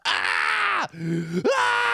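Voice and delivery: high mock yells of agony; yelping high like someone in pain; mock pain screams. Podcast host mimics the guest's screaming, high mock yells. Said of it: "Any words are just".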